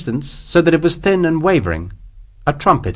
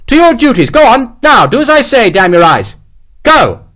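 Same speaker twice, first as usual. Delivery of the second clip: loud, high officer's bellow; shouted orders; 11 semitones higher, 7 dB louder than usual. A narrator's reading. Officer sending pursuing men back to duty, shouting. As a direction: shout them down with full authority. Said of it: "Lawford shouted".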